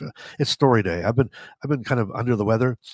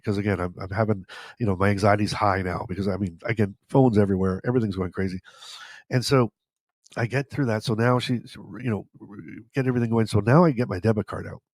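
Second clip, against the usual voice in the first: usual speaking voice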